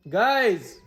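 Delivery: loud high call to the viewers; excited shout of guys. Real, not acted. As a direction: grab their attention, high and loud. Calling out to viewers.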